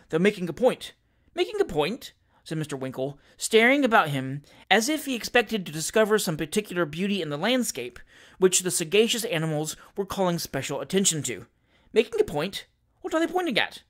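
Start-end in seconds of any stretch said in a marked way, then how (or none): 1.3-2.1 high-pitched baffled question; puzzled echo. Acted. Novice shooter puzzled by the hunting term, puzzled echo. Winkle echoes the unknown term. 11.9-13.8 high-pitched, puzzled questions; baffled questioning. Acted. Novice asking what the dogs point at, baffled questioning.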